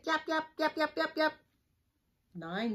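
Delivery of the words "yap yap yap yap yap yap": high yappy little dog; yapping puppy. Acted. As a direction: yap like a tiny dog. Small dog yapping in book.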